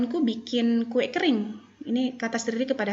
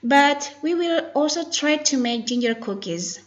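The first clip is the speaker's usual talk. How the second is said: slow, louder, higher careful reading; model pronunciation reading; 5 semitones higher, 5 dB louder than usual. Shown as a demonstration. Teacher models the cookie line for students, slow and emphatic. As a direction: spell out each word clearly for learners. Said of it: "focus to pronunciation and intonation".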